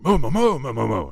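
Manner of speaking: loud high moo tumbling down into quick moos; urgent moos like breaking news; excited cow. Acted. Cow reports back to the other cows, excited high mooing. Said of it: "and they're like".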